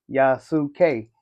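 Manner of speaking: "Yasuke" sounded out syllable by syllable, yah-soo-kay; "Yasuke" with every written vowel voiced; sounded-out pronunciation. Shown as a demonstration. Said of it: "sound out your words".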